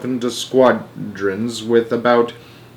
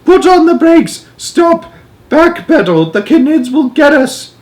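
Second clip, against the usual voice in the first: very high, loud yelling; grandma's panicked shout; 6 dB louder, slower than usual. A narrator's reading. Grandma begging the pilot to stop, shouting. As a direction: shriek orders to stop. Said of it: "shouted Grandma Josephine".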